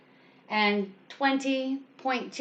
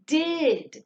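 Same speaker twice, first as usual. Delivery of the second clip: did stretched out and pitched up; a higher, emphatic did; stressed did; 4 semitones higher than usual. Shown as a demonstration. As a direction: say did alone with exaggerated emphasis. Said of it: "you're going to stress that word".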